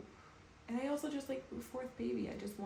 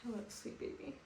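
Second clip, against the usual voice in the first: tender whisper; 4 semitones higher, 4 dB quieter than usual. Real, not acted. Greeting unborn baby tenderly.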